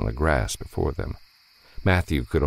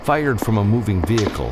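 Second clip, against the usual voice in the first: ordinary delivery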